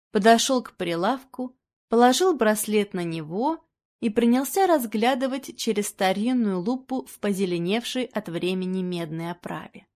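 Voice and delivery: plain reading voice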